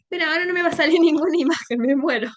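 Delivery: real amused exasperation, rushed and high; laughing dismay. Real, not acted. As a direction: laugh helplessly at a small mishap. Exasperated laugh as slides fail.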